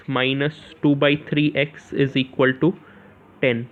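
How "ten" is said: usual speaking voice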